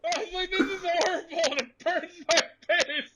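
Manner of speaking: high shrill disbelief, burning face; mock outraged shriek. Telling friends about smelling salts, high outraged squeal. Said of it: "I'm like".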